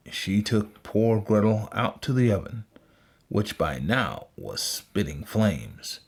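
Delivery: ordinary delivery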